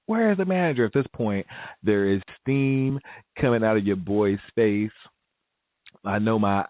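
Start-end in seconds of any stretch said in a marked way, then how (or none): 0.1-0.8 demanding, pitched up. Retold or imitated. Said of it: "I'm like".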